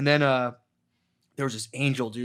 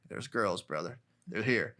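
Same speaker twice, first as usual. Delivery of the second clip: calm him down gently and low; soothing reassurance; 4 semitones lower, 8 dB quieter than usual. Retold or imitated. Imitating himself soothing tripping Spud, low and soft. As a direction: lower and much softer.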